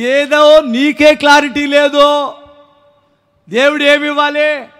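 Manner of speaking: ringing, half-speed scolding; drawn-out rebuke. Real, not acted. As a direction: stretch out each word for the congregation. Repeats rebuke slow and raised.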